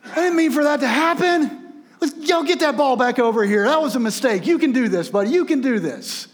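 a wrestling heel pretending to be kind; fake-sorry villain. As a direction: Million Dollar Man taunting the little boy, high and loud. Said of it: "He goes".

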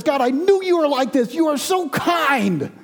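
high-pitched, exasperated protest; angry complaint. Acted. Jonah accuses God of being kind, high indignant cry. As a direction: throw God's kindness back as an accusation, slowing at the end. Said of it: "to level a complaint on God".

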